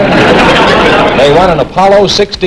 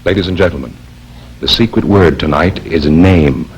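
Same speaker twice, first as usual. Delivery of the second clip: announcer; 10 semitones lower than usual. Acted. Announcer reveals the secret word.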